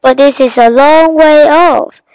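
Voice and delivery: calling out. A narrator's reading. Nadim up the tree reporting a far boat, calling out. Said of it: "he called".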